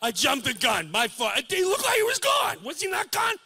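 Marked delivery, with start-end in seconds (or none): 1.3-3.3 high, loud astonished questions; startled disbelief. Retold or imitated. Son shocked that his father is still alive, high disbelief. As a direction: still shaken from the fright. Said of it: "startled at father reviving".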